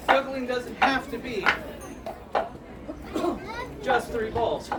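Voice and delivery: ordinary talk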